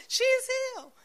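very high, amazed she's healed; high stunned delight; awed exclamation. Retold or imitated. Amazed at healing.

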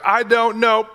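high, laughing brush-off; mock Peter. Peter dodging the servant girl, laughing it off. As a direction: toss it off with a laugh like a caught disciple. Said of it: "he's like".

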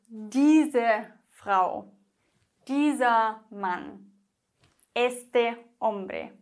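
slow, pointing out diese and dieser; slow pointing example. Shown as a demonstration. Teacher slowly demonstrating pointing pronouns.

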